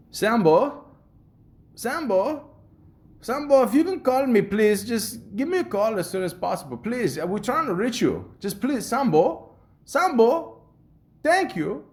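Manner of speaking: high, loud imitated Jamaican lady; pleading Jamaican accent, calling the name up high. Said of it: "my accent skills need work".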